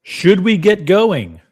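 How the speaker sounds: high, loud and drawn out, rallying the chat; hyped up call. Real, not acted. Streamer rallying his viewers, high and loud, slow hype call. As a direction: stretch it out like a hype man.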